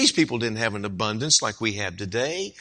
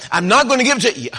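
loud, much higher and fast; irritated refusal; 11 semitones higher, 6 dB louder, faster than usual. Acted. Neighbor refuses again.

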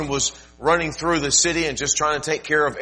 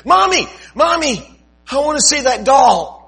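put-on much higher, louder child's voice begging; little girl pleading; 11 dB louder than usual. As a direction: squeal like a small girl wanting a toy. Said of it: "Joy, a little four or five-year-old girl said".

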